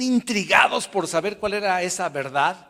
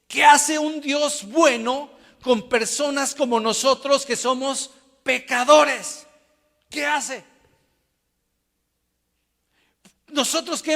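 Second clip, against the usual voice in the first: loud, high, urgent preacher's cry; impassioned preaching; 9 semitones higher, 6 dB louder than usual. Real, not acted. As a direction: drive each accusation home to the congregation. Predica con toda su fuerza. (predicación vehemente, alta y fuerte)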